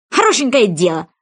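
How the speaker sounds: indignant mouse exclamation; mouse's outraged exclamation; exclaiming mouse. Acted. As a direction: blurt it out, outraged. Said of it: "воскликнула мышь".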